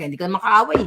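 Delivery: normal talking voice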